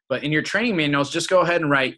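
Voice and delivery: ordinary talk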